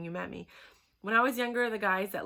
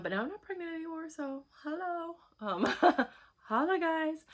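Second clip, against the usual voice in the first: playful tease; 9 semitones higher than usual. Real, not acted. Teasing announcement to viewers.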